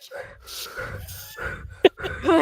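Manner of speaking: audible nervous breaths, no words; imitated heavy breathing. Hunter showing how she sounded on the video, exaggerated breathing. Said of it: "you can hear me breathing".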